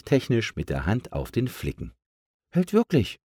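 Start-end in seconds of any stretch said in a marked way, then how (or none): 2.5-3.2 high, thankful balloon voice; grateful balloon. A narrator's reading. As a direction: pitch it up in pleased confirmation. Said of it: "meinte er dann und schaute ... dankbar".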